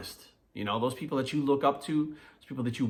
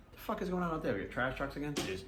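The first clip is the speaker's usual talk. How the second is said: distracted aside; 8 dB quieter than usual. Real, not acted. Aside about trash trucks outside.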